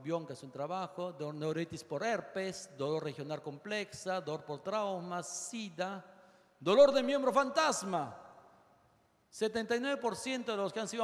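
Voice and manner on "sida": usual speaking voice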